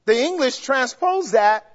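ordinary talk, nothing marked

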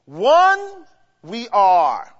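"One we are" drawn out very slowly, ONE weighted; slow emphasis. Shown as a demonstration. Preacher slowly stresses the corrected word order for the congregation. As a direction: lay each word down slowly. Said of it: "when they should have left it".

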